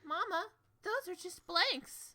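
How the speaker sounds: high, soft, slow little voice; put-on high, drawn-out daughterly voice; child-to-mama voice. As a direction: gently point it out to Mama in a small, high voice. Acting Lainey speaking to her mother.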